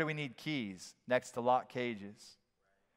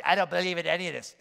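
skeptic voice; 2 semitones higher, faster than usual. Pretend. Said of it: "you can say whatever you want to me".

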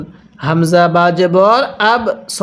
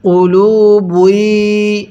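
very drawn-out long vowels; slow teaching chant; model recitation; much slower than usual. Shown as a demonstration. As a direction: hold each syllable for repeating. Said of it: "আরেকবার পড়ুন".

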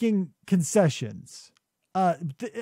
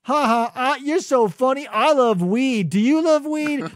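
higher, louder goofy caricature; hammy mocking imitation of shallow characters; mocked movie characters; 6 semitones higher, 4 dB louder than usual. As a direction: push it up high and play it dumb. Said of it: "all of his characters are like".

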